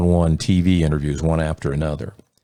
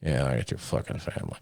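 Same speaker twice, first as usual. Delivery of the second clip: low, much softer muttered curse; under your breath; 5 semitones lower, 11 dB quieter than usual. Retold or imitated. Muttering an insult under your breath after a nice greeting. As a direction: grumble it to yourself. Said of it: "under your breath".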